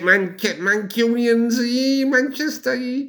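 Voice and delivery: high, slow, lightly hammed Manchester accent; Mancunian accent. Doing the Manchester voice from the list, high and slow. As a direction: stretch it out Manc-style.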